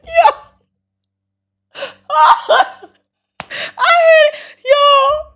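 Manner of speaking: high squeal, overcome with laughter; cracking up. Real, not acted. Streamer failing to talk to viewers, high and cracking up. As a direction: try to speak but squeal with laughter. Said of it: "I'm cracking up".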